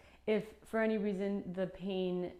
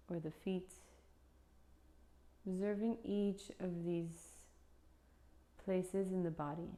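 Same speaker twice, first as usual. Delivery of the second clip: guided meditation. Acted. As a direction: lead them gently into stillness, unhurried.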